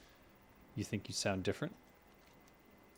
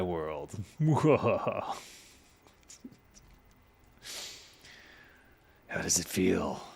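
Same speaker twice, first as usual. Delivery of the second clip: gloating villain; slower than usual. Pretend. Taunting the chat member as villain.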